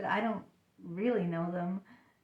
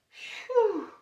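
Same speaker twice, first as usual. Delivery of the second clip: high whooshing sigh of relief; relieved phew; 13 semitones higher, 4 dB louder than usual. Acted. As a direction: blow out relief at having escaped. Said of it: "finally get away".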